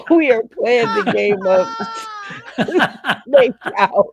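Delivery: loud, high, breaking into laughter; laughing announcement. Real, not acted. Host announces the game to the contestants, cracking up. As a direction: try to announce it through real laughter.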